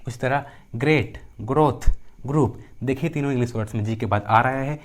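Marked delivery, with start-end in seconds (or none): 1.4-2.6 ग्रोथ and ग्रुप punched out with hard G. Shown as a demonstration. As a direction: call out ग्रोथ, ग्रुप as model words. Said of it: "जी का उच्चारण ग होता है". (ग्रोथ, ग्रुप में G को "ग" बोलते हुए)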